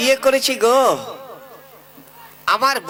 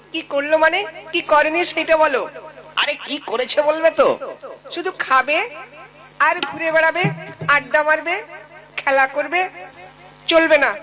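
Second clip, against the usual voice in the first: mock-shrewish wife voiced higher; scolding wife; 5 semitones higher than usual. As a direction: rattle off the brother's faults as the sharp-tongued wife.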